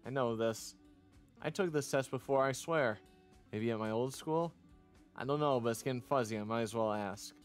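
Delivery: usual speaking voice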